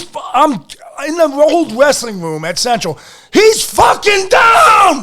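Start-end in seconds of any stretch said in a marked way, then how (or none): 3.3-5.0 high, loud, slow yell. Retold or imitated. Wrestler yelling to the coach mid-match, full shout. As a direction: shout it across the wrestling room. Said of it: "and I'm yelling".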